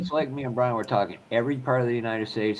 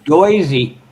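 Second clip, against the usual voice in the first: Jersey accent; 7 semitones higher, 11 dB louder than usual. Shown as a demonstration.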